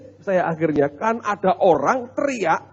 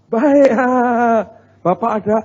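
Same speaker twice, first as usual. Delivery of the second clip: higher, louder mock-casual reply; lightly voiced wife; 5 semitones higher, 7 dB louder, slower than usual. Wife answering the visiting pastor at the door, lightly imitated loud cheer. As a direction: play the wife answering as if all is fine.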